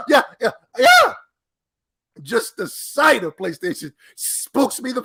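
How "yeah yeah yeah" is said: shrieking laugh into yelled yeahs, much higher and louder; howling laugh. Real, not acted. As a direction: lose it completely, laughing out loud. Cracking up at Jim Ryan.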